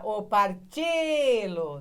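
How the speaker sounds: "partilo" called out loud and drawn out; calling out the word. Shown as a demonstration. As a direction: call "partilo" slowly as one word, stretching the stressed "ti". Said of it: "Você pronuncia tudo junto pra chamar".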